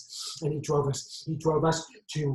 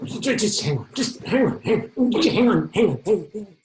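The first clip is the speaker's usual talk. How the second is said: urgent, stammered burst of shouting; frantic high-pitched command; agitated shout; 6 semitones higher, 6 dB louder than usual. Acted. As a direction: shout higher and louder, repeating in panic. Struggle with knife-wielding suspect.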